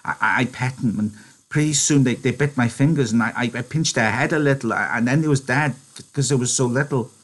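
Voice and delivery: put-on high, halting childlike confession; Lenny, stammering. Lenny explaining how the mice died, stammering. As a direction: stumble through the excuse.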